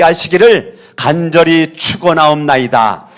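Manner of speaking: solemn, sustained pastoral blessing; benediction. Real, not acted. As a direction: pronounce the closing blessing, ending on a long amen. Pronounces closing benediction. (엄숙한 축도)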